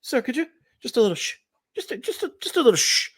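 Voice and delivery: high, hesitant mock-polite waiter; high-pitched, apologetic waiter voice; shushing waiter. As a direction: imitate a waiter delicately asking for hush. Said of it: "The waiter came up to me and he's like".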